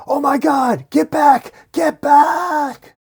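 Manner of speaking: very high, loud panicked scream; frantic shout, stretched on the last word; screaming warning. A narrator's reading. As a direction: scream to clear everyone from danger. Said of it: "looking out the window screaming".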